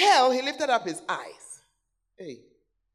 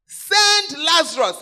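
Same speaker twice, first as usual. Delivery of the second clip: loud, slow, commanding demand; giving orders; 6 semitones higher, 10 dB louder, slower than usual. Acted. Rich man in hell ordering Abraham, loud command. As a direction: bark it like a master giving orders. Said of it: "He's even giving orders".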